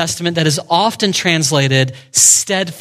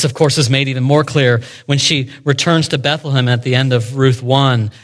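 normal talking voice

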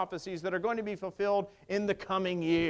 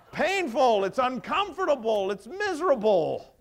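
mock pained; 6 semitones higher, 7 dB louder than usual. Kidney stone comic riff.

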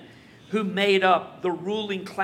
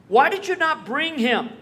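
louder, higher, accusing question; demanding rebuke; 7 semitones higher, 6 dB louder than usual. Retold or imitated. Chief priests scolding the officers, loud and demanding. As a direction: press the officers for an answer.

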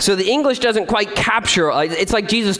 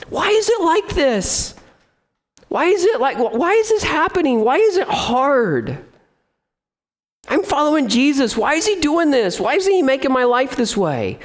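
a hint of a whiny complainer, pitched up; mock complaining; 6 semitones higher than usual. Pastor mimics believers griping about hardship, lightly whiny. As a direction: whine it like a put-upon believer. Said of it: "we just start complaining".

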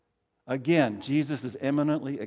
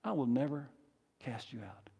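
gentle reply, dropping to near-whisper; Jesus' reassurance; 2 semitones lower, 11 dB quieter than usual. Acted. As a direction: answer softly, pausing before the last words. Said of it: "The response of Jesus".

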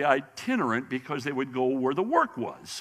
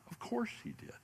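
breathy whisper, much higher; whispered aside; 11 semitones higher, 13 dB quieter than usual. Real, not acted. Preacher tells the congregation an obvious aside, whispered. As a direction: drop to a confiding whisper.

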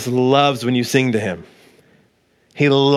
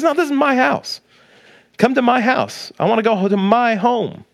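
lightly imitated little boy, higher pitched; a hint of an insistent child, voice lifted high; six-year-old son; 8 semitones higher than usual. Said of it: "my six-year-old son, he doesn't walk".

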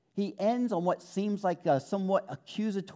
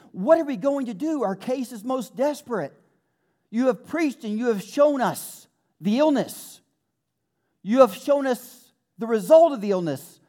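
desperate crowd plea; 8 semitones higher, 6 dB louder than usual. Retold or imitated. Crowd begging the apostles, building.

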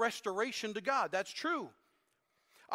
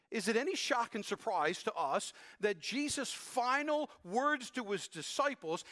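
ordinary talk, nothing marked

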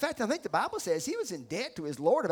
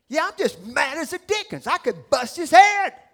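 louder, higher, mad as can be; angry outburst; 7 semitones higher, 11 dB louder than usual. Acted. Churchgoer telling what he really feels, angry outburst. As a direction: blurt it out in loud indignation.

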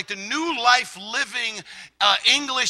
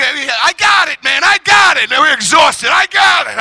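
high, loud excited shout; triumphant discovery; 5 semitones higher, 8 dB louder than usual. Retold or imitated. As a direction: shout it like you finally found it. Acts out finding the page.

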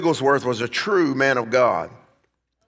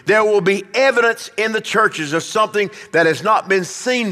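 raised, forceful preacher's declamation; preaching prophecy; 5 semitones higher, 3 dB louder than usual. Acted. Preacher reads a revival prophecy to the church, fervent proclamation. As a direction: proclaim it high, building in force.

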